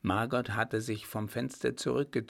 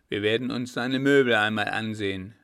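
louder, threatening; loud menace; 8 dB louder, faster than usual. A narrator's reading. A looter insists on seeing the furniture, loud and menacing. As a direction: push back with a loud threat.

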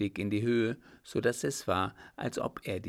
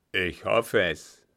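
curt captain; 7 dB louder, slower than usual. Acted. Captain's short reply.

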